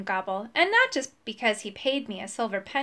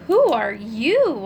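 louder, slow startled question; startled cry; 6 dB louder, slower than usual. A narrator's reading. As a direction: blurt it out in shock. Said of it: "I cried".

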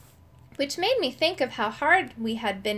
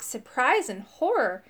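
ordinary delivery, nothing marked

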